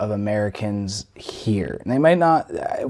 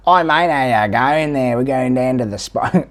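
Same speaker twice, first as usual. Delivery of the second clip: Australian accent. Shown as a demonstration. Having a try at the challenge, broad Australian accent. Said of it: "I have to try".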